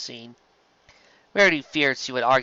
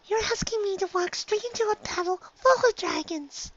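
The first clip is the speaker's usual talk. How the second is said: much higher, softer shy little voice; Fluttershy timid; 4 dB quieter than usual. Acted. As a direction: barely dare to speak up.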